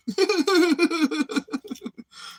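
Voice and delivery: high burst of laughter; high cackling laugh. Real, not acted. Laughing at his own crooning, high cackle. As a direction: cackle at your own joke. Big laugh after lyric.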